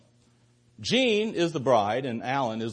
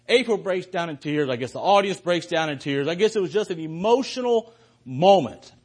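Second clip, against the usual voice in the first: usual speaking voice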